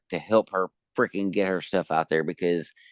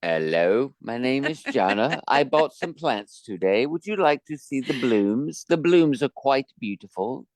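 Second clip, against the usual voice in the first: prim, proper English lady voice; posh British lady; 4 semitones higher than usual. Retold or imitated. Mocking how the author would voice Jana, posh English. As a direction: imitate a posh educated woman.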